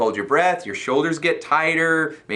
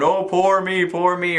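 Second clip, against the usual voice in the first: higher, whiny "woe is me"; mock self-pity; 6 semitones higher than usual. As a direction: make fun of feeling sorry for yourself.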